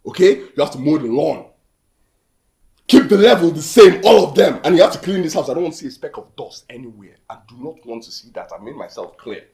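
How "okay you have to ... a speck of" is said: loud, high-pitched commands; angry orders. Acted. Boss giving a new worker house chores, loud and angry. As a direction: lay down the rules forcefully.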